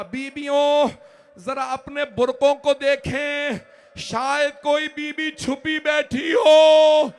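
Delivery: high, loud, slow wailing recitation; very high, loud keening sermon; climactic majlis lament. Acted. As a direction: drive the mourners to weep, crying out. Preacher's climactic lament to women.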